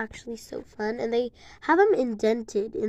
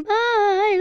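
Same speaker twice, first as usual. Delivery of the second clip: excited goodbye; 11 semitones higher, 8 dB louder than usual. Real, not acted. Excited sign-off to viewers.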